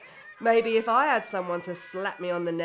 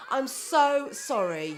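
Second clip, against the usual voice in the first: usual speaking voice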